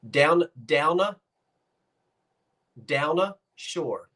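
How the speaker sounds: "downa" as one word, slowly demonstrated; local "downa shore". Shown as a demonstration. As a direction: teach "downa shore", "the" shrunk to a. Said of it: "Watch. Watch".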